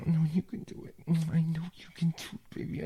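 ordinary delivery

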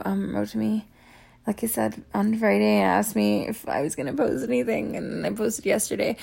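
usual speaking voice

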